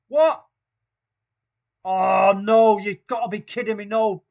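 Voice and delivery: sudden high, loud shock; shocked disbelief. Real, not acted. Commentator reacts in shock to a chat message. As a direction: gasp out the disbelief.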